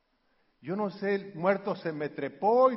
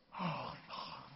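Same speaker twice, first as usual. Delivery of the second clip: ordinary delivery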